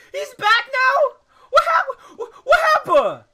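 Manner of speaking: laugh-crying, very high and loud; words squeezed out through hysterical laughter; shrieking laughter. Real, not acted. Player sees Punio reappear, shrieks with laughter. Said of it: "Excuse me".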